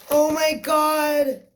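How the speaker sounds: high, loud and slow with disbelief; real thrilled cry; excited shriek. Real, not acted. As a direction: let the joy burst out loud. Overwhelmed unboxing a wished-for palette.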